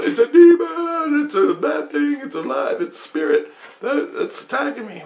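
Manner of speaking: high-pitched, hammed-up ghost hunter alarm; mock panic. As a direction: mimic a terrified ghost hunter.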